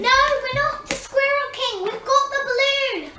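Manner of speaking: high, insistent calling to a crowd. Pretend. Child player corrects frightened townsfolk, calling out high. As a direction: set them straight, pitched well up.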